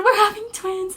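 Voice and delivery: retold high, giddy reveal; excited announcement. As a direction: squeal the news at moderate volume.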